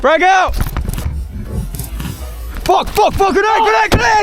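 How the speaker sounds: high panicked yelling; grenade warning yell. Real, not acted. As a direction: scream the warning to the squad. Grenade thrown and incoming.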